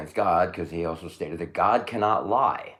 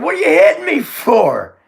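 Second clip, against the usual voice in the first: talking donkey; 10 dB louder than usual. Retold or imitated. Donkey voiced in Bible story.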